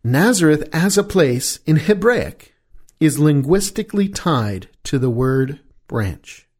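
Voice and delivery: ordinary talk, nothing marked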